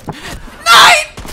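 high loud no; shocked cry. Real, not acted. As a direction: cry out in dismay. Fenster kaputt, Aufschrei. (entsetzter Aufschrei)

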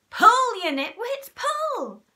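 very high, loud shout; yelled order. A narrator's reading. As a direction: heave the carpet together. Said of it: "said Mugglewump".

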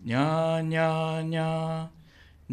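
very slow drawn-out nyas; slow motion. Shown as a demonstration. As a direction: hum it at half speed. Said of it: "ゆっくりです".

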